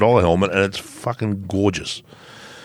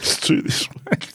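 whispering the line back; whispered echo; 5 dB quieter than usual. Acted. Echoing his co-host's announcement in a whisper. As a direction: hush it like a secret.

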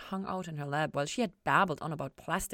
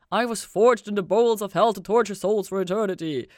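loud, ominous demonic declaration; loud menacing demon voice; demon boast; 4 semitones higher, 11 dB louder than usual. Retold or imitated. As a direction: intone it like a proud demon. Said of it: "Mace had said to Ella".

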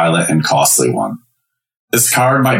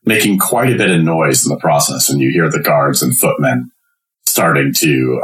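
ordinary talk

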